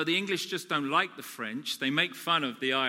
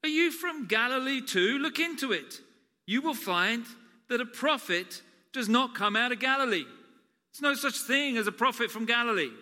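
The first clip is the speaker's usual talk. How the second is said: higher, haughty, dismissive voice; 7 semitones higher than usual. Retold or imitated. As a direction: sneer at Nicodemus's question. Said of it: "They said".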